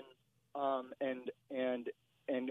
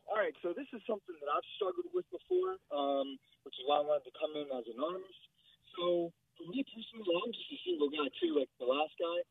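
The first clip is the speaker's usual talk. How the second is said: bad phone line, muffled voice; 6 semitones higher than usual. Real, not acted. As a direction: sounds like through a wall. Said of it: "you sound like you're muffled".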